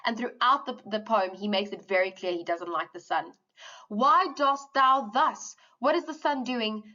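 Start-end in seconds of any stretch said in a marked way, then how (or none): 3.9-5.5 drawn out and pointed; slow, emphatic recital. Acted. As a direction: lean on each word. Quotes poem line slowly.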